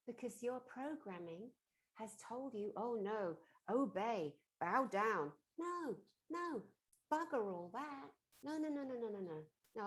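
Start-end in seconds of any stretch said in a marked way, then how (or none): 2.8-5.3 very loud, booming orders; mock command. Programming orders people to submit, loud mock command. 5.6-9.8 high, loud, emphatic rejection; defiant refusal. Acted. Speaker refuses to bow down, loud high defiance.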